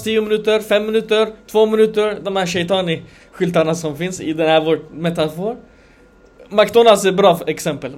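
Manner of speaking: ordinary delivery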